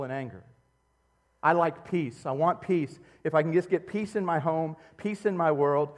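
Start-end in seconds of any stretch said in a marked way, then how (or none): none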